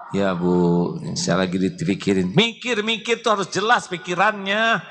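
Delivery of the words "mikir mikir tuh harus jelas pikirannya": wife nags her silent husband, high and sharp; nagging wife. Acted. Wife complaining to her husband, high-pitched scolding.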